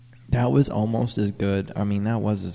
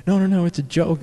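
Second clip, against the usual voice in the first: urgent reassurance; 7 semitones higher, 6 dB louder than usual. Retold or imitated. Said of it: "we're like".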